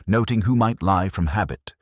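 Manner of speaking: plain reading voice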